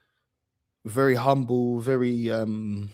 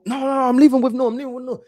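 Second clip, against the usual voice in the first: put-on high, loud stubborn old man refusing to budge; stubborn granddad; 11 semitones higher, 6 dB louder, faster than usual. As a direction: dig in like a tipsy grandpa who won't go home.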